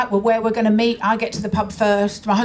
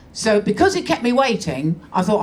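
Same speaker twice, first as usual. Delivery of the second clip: usual speaking voice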